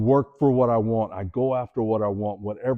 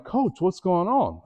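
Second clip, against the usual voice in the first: confused question; 9 semitones higher, slower than usual. Retold or imitated. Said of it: "And I'm like".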